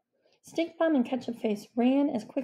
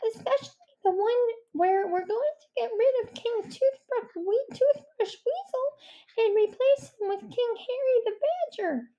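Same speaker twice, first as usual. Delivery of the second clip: high badger voice; 14 semitones higher than usual. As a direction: play a timid badger, high and careful. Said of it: "said Stuart the Badger".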